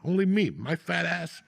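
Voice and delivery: usual speaking voice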